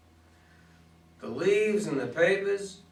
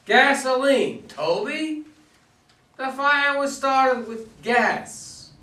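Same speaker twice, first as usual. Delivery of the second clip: sharp challenge; 6 semitones higher, 4 dB louder, slower than usual. Acted. Cop confronts suspect about gasoline.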